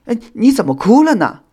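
high, loud flustered question. A narrator's reading. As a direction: panic a little at a friend's tears. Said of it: "修想有些慌乱了阵脚". (慌乱地问)

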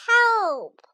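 loud, high, drawn-out "Help!"; a storybook character crying out. A narrator's reading. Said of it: "cried Toad".

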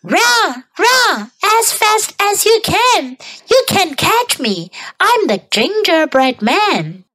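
high, sing-out taunt; taunting gingerbread man. A narrator's reading. Gingerbread man taunts the chasing old couple, high-pitched. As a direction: tease the chasers in a high voice. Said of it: "He laughed and said".

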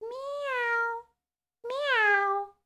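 soft, very high mewing; tiny, high-pitched meows; cat meow. A narrator's reading. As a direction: imitate a little cat crying. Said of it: "cried the cat".

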